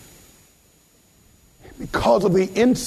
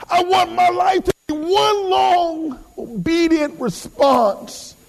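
high, stretched preaching cry; impassioned preaching; 11 semitones higher, slower than usual. Real, not acted. Preacher declares obedience to God, high and impassioned. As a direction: draw the words out high and forceful.

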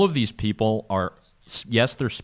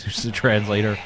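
ordinary delivery

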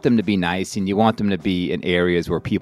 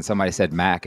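ordinary delivery

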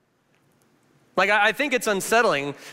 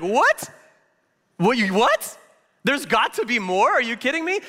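what, are you kidding me, higher pitched; incredulous; 6 semitones higher than usual. Retold or imitated. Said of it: "I was like".

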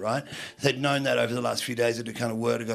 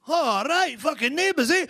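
high-pitched, thrilled approval; comic starstruck excitement; acted delight; 13 semitones higher than usual. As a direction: exclaim it high, like a won-over thug. Gang leader delighted by soap actor.

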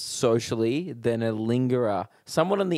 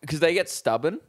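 usual speaking voice

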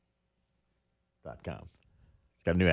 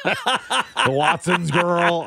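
laughing; 7 semitones higher, much slower than usual. Real, not acted. Laughing callout of a memory.

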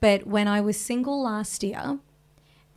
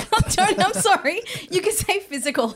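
high, loud, giggling apology; laughing; 8 semitones higher, 4 dB louder than usual. Real, not acted. Laughing at dad's word.

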